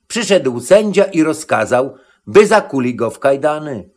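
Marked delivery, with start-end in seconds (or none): none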